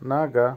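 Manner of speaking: নাগা with the written o sounded "a". Shown as a demonstration. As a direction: say নাগা as "na-ga", open a. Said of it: "উচ্চারণটা হবে আর মতো". (নাগা, ও-এর উচ্চারণ আ-এর মতো)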